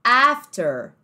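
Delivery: after with a hard rolled-back R; strong American R. Shown as a demonstration. Teacher shows the American R to students. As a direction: say after with the R clearly sounded. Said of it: "ele pega o R bem forte aqui".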